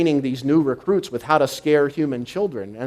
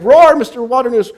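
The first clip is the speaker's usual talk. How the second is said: higher and louder, urging "Roar"; loud coaxing roar; 10 semitones higher, 11 dB louder than usual. Retold or imitated. Sully asking Waternoose for a roar, in a raised urging voice. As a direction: push the word "Roar" out loud and high. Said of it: "He's like".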